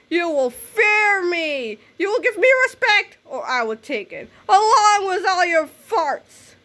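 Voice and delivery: slow, menacing, swinging high; villain threat. Acted. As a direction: savour the threat.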